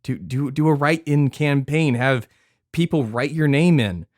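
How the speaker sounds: loud and high, pushing the idea hard; excited pitch. Real, not acted. Podcast cohost urging his friend to run, excitedly loud. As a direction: sell him on it with rising excitement.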